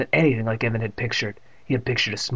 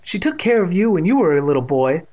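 higher, louder arguing mother; 6 semitones higher, 5 dB louder than usual. A narrator's reading. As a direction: push back on the father. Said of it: "Miss Ross had argued".

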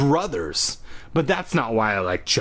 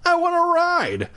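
put-on pushy little girl. A narrator's reading. Marnie begging a ride.

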